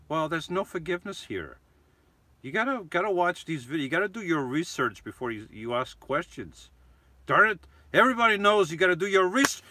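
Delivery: mock outrage at a lazy viewer; play-acted scolding. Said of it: "I was just acting".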